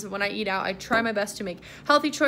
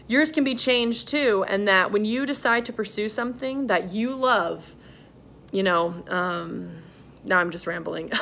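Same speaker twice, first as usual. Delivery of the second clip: ordinary delivery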